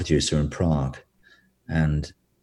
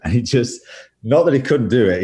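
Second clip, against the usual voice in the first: ordinary talk, nothing marked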